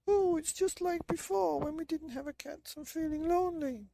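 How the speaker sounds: put-on very high, plaintive old woman; lonely old woman. Old woman lamenting to her husband, very high voice.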